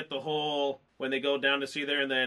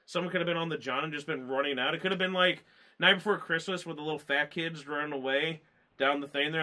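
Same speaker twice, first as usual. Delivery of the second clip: normal talking voice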